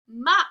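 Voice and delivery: "Mop" loud, with a popped final "p"; final sound stressed. Shown as a demonstration. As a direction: call out "Mop" alone and puff the "p". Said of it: "really listen to my words".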